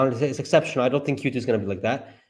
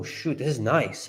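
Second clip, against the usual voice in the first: ordinary delivery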